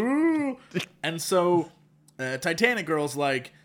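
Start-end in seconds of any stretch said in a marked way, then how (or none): 0.0-0.6 high, drawn-out "ooh" of fake suspense; spooky ooh, hammed up; mock-ominous ooh. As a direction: tease the doom with a high ooh. Mock suspense after "destroy it.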